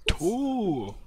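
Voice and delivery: high, loud moose-like yell; moose bellow. Retold or imitated. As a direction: bark it out like a cartoon moose. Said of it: "Sounds like a moose".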